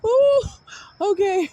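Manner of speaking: high squeaky words through real laughter; barely getting words out for laughing; laughing hard. Real, not acted. Retelling the friend's piano story, cracking up with real laughter. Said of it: "I hope I don't start crying".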